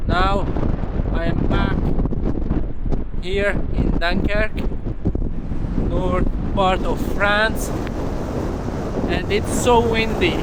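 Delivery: pitched high, pushing through wind; shouting over wind. Real, not acted. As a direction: speak up over the gusts. Outdoor clip, strong wind continues.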